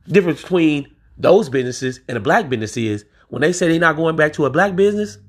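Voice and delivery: normal talking voice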